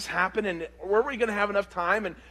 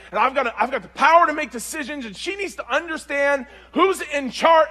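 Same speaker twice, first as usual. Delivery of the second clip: acted-out indignant rant, loud and high; raised, worked-up tirade in mock outrage; frustrated mad; 6 semitones higher, 6 dB louder than usual. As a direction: rant as if your authority was challenged. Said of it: "I got mad, frustrated mad".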